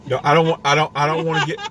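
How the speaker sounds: ordinary talk, nothing marked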